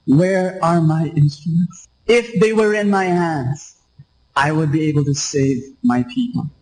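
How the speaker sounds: ordinary talk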